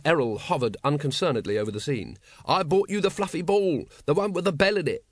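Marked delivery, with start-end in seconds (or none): 2.4-5.0 high-pitched, coaxing appeal; urgent, wheedling call; pleading. A narrator's reading. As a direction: plead with a pet that ignores you. Vimes pleads with the hovering Errol.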